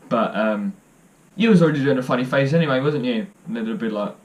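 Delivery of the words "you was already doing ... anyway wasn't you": loud, rapid cheeky tease; teasing jibe. Acted. Father Christmas ribbing a visitor during photos, loud quick teasing. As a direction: fire off the punchline fast and louder.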